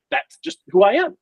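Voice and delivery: usual speaking voice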